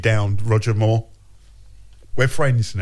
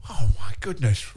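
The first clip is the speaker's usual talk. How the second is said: ordinary delivery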